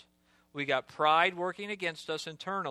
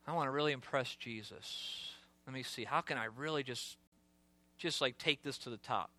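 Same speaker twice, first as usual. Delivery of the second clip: dropped to a lower, much softer scheming aside; sly inner thoughts; 4 semitones lower, 9 dB quieter than usual. Acted. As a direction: muse to yourself as Peter plotting to impress. Peter's inner thoughts, acted aside.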